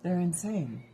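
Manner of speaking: usual speaking voice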